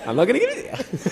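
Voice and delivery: P=A; sing-song teasing laugh. Real, not acted. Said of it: "teasing refusal repeated".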